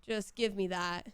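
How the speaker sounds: ordinary talk, nothing marked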